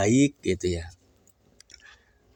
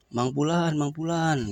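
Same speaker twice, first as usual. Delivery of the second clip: raised call; 6 semitones higher, slower than usual. Acted. Scholar showing the rich man how to call at the well, higher. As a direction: show how to call the name.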